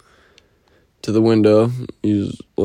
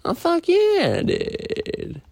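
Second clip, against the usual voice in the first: mock excited; 5 dB quieter, much slower than usual. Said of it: "I'm like".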